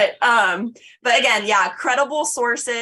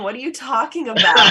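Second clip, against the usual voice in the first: laughing; 4 semitones higher, 6 dB louder than usual. Real, not acted. Joking at cohost, laughing.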